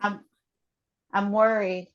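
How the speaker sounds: slow statements with long pauses between, for the group to repeat; guided tapping statements. Acted. Facilitator leading the group through tapping, slow and measured. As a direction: lead each feeling out calmly, one by one.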